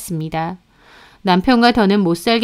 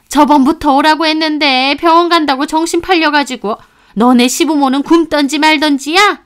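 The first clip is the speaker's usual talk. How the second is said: put-on higher, sharp-tongued older woman scolding; scolding mother-in-law; 5 semitones higher, 5 dB louder than usual. Mother-in-law lecturing her daughter-in-law at the funeral, higher and scornful. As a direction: berate her like a contemptuous mother-in-law, pitched up.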